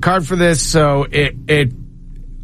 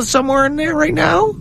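mock innocent self; 10 semitones higher than usual. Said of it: "And I go".

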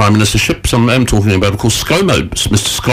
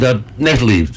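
usual speaking voice

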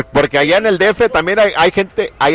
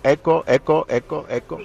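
fading echo repeat; each eco softer and farther; echo imitation; 5 dB quieter than usual. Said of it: "me escucho con eco".

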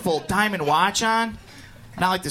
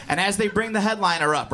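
normal talking voice

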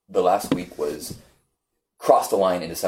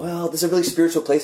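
tentative explanation; 10 semitones higher, 3 dB louder, faster than usual. Retold or imitated. Offering the man an explanation.

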